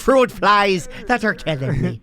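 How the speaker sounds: teasing copy of a bad Irish accent; mock Irish accent. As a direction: parrot a shaky attempt at an Irish accent. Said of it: "That was an Irish accent".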